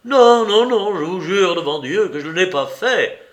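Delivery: loud, high broken denial; desperate cry. A narrator's reading. The collapsed prisoner crying out his denial to Holmes. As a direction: cry out your innocence before God. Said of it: "s'écria notre prisonnier effondré".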